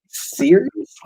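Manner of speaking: high, rising incredulous question; retold disbelief. Artist retelling his reply to a jam piece offer, incredulous. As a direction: ask it as if you can't believe the offer. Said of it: "that's how I answered him".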